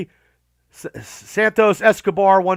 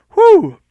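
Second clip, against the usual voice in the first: big tired whew; 11 semitones higher, 8 dB louder than usual. Real, not acted. Relief mid long list.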